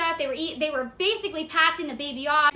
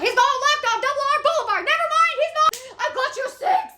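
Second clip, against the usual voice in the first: high, loud chase commentary; frantic mock shout; 10 semitones higher, 6 dB louder than usual. Caller relaying a car chase to officer, frantic shout. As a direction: shriek the directions like a hammed-up pursuit.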